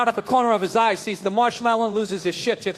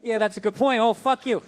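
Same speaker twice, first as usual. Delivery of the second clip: normal talking voice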